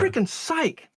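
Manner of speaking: high-pitched burst of frustration; exasperated. Real, not acted. Host talked over by co-host, exasperated. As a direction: let out a fed-up minced curse. Exasperated at being interrupted again.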